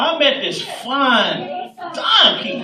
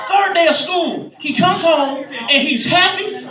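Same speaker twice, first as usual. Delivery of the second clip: ordinary delivery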